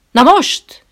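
loud, high snap of an order; barked command. A narrator's reading. Trainer snapping an order at the visitor, loud. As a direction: bark it at him like a drill sergeant. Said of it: "rival rám az idomító".

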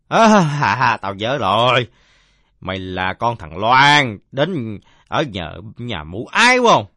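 loud, slow, drawn-out recollection; drunkard suddenly remembering. Acted. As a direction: stretch the words out as memory dawns. (giọng ông say à lên, kéo dài, nhớ ra)

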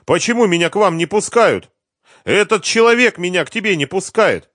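high, loud, indignant wife; agitated woman shouting to be let in; wife's voice. A narrator's reading. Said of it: "раздался голос жены".

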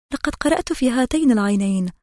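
plain reading voice

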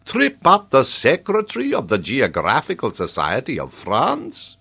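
high, incredulous question rising at the end; indignant geographer. A narrator's reading. Geographer answers the major's tease, indignantly incredulous. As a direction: puff up with wounded pride.

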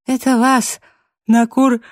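joking through a big yawn. A narrator's reading. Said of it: "отчаянно зевая, попыталась пошутить я".

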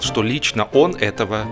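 plain reading voice

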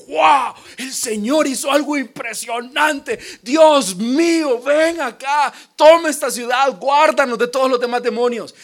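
loud, high ecstatic praise shout; joyful praise. Retold or imitated. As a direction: cry out in wonder to God, pleading him to stay.

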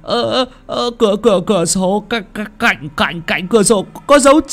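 frightened servant wailing to the master; terrified wail. A narrator's reading. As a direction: stammer and build to a panicked howl. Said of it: "tái mặt mà gào lên".

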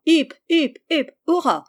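narrated three-cheers salute, lifted in pitch; a brief storyteller's hip-hip-hurrah; cheering. A narrator's reading. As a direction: call out a contained cheer within the narration. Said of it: "la salua de trois".

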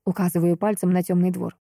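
usual speaking voice

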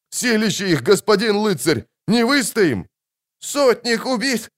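very high, wailing soldier's voice; panicked moaning. A narrator's reading. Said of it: "простонал другой". (панически стонет высоким голосом)

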